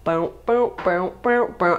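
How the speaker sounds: bouncy "bow, bow" sound effect, rising in pitch; boat-like car wallowing noise, repeated; imitated car bouncing. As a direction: imitate bad shocks bobbing over speed bumps. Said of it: "when I'd go over speed bumps, it would, like".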